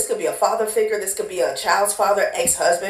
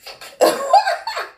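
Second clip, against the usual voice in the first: high laugh. Real, not acted. A tarot reader laughing at the image of spit flying, a high squealing laugh.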